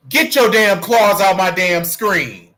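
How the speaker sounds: real high, loud yell at the cat. Real, not acted. Streamer scolding the cat on the screen, yelling. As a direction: holler at a misbehaving cat. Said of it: "I'm yelling at the cat".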